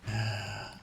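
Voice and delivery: soft, breathy sigh. Real, not acted. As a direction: let out a quiet sigh at the grim news. Reacts to the grim ending.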